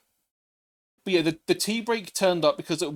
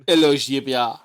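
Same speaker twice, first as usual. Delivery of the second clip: put-on louder French accent greeting; 6 dB louder than usual. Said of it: "now we're French".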